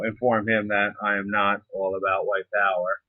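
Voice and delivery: usual speaking voice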